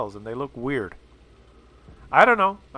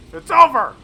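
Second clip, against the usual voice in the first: 'over' yelled much higher and louder; victory shout; 14 semitones higher, 11 dB louder than usual. Real, not acted. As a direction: roar it out as the win lands. Tank wins the fight.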